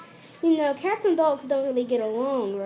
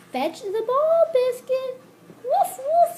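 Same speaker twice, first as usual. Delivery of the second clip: put-on high, loud girl calling her puppy; excited little girl; 11 semitones higher, 7 dB louder, slower than usual. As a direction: call the dog like an eager little girl. Teacher voices girl reading Biscuit.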